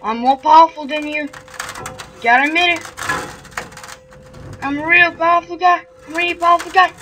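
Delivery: loud, fast, high boast; bragging. Acted. Player psyching himself up against the ghost, loud fast boast. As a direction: puff yourself up and shout it.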